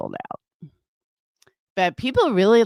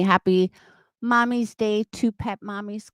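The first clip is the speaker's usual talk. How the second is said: ordinary delivery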